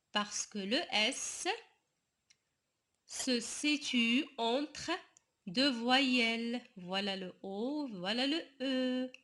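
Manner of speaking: ordinary delivery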